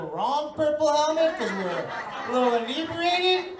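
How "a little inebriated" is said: normal talking voice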